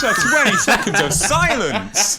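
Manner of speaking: loud, high outburst over laughter; exasperated shout. Real, not acted. Co-host scolds Stephen for breaking the silence, exasperated shout. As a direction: yell it in comic exasperation.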